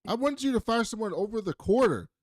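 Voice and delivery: high, outraged scolding; mock angry boss; furious boss. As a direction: tear into him over the bad call. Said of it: "And Adler's like".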